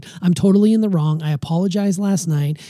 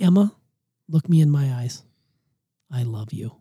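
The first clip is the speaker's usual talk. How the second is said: mock-solemn sincerity; 3 semitones lower than usual. Shown as a demonstration. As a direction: act out a grave, heartfelt confession with pauses. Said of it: "it's not like".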